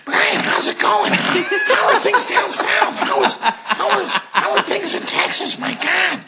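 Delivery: very high, strained Marge Simpson-like voice; aunt's Marge Simpson rasp. Retold or imitated. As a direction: gush like an excitable aunt, high and drawn out. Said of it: "she sounds a little like Marge Simpson".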